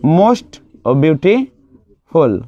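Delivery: "most beautiful" said very slowly, word by word; slow model answer. Shown as a demonstration. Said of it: "superlative में जाएगा तो".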